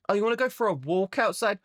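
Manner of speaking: a hint of a breezy Australian local; mock Aussie local. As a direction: cheerfully warn of deadly things. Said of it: "every creature there wants to kill you".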